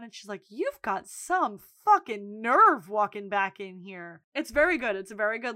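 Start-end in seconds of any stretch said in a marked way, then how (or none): 0.5-4.2 slow, punched-out retold scolding; telling off. Big sister scolding woman before everyone, telling off. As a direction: draw out each indignant stressed word. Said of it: "tells Fiona off in front of everyone".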